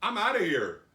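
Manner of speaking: loud, high burst of resolve; acted emphatic exclamation; eager exit. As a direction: blurt it as the healed man, raring to leave. Acting healed man eager to leave.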